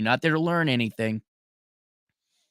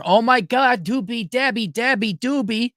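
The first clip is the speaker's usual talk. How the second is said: mocking sing-song; 10 semitones higher than usual. Mocking caller's brag.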